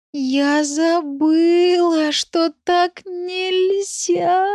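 very high, long drawn-out wail; whimpering dog. A narrator's reading. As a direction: stretch every word into a guilty whine. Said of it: "проскулила Ириска". (протяжный виноватый скулёж)

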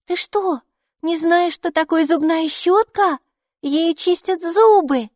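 put-on high, loud, drawn-out child voice; incredulous child. A narrator's reading. Майки explains a toothbrush to gatekeeper, high incredulous child voice. Child amazed at adult's ignorance.

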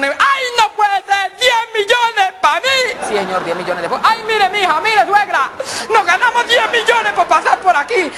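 high, slow, loud squeal of delight; squealing joy. Acted. Driver telling wife and mother-in-law about the prize, high delighted squeal. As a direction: squeal over the prize money.

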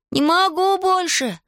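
high, drawn-out wail of giving up; crying out. A narrator's reading. Алик gives up running after Иван, crying out. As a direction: call it out high and stretched, without much volume. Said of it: "крикнул Алик".

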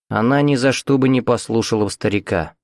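plain reading voice

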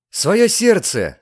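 high, loud and slow, in rapture; delighted exclamation. Acted. Lover receiving Diana's heart, exclaims in delight. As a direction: savour the words with delight. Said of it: "с восхищением воскликнул возлюбленный".

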